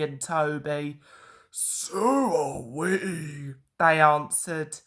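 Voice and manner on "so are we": slow, drawn-out engines answering; slow engine voices. Acted. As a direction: draw it out slowly.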